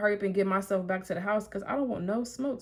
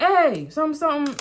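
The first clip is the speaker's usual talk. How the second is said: higher, louder hail; calling out; 7 semitones higher, 5 dB louder than usual. Retold or imitated. Ex shouting to her across the lot. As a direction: call across the parking lot.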